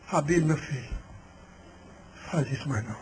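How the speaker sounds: put-on low, soft weary old man; hushed, deep fatherly plea; old pastor. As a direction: imitate an elderly pastor gently pleading. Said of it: "velho, homem de Deus".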